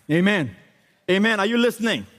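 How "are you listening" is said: normal talking voice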